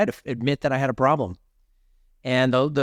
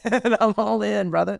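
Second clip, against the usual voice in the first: laughing; 8 semitones higher than usual. Real, not acted. Laughs while committing.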